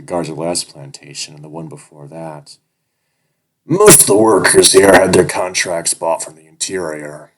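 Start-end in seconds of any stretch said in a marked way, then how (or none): 3.6-7.3 jovial. Acted. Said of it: "remarkably jovial tone".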